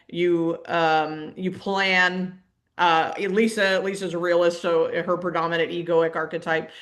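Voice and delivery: normal talking voice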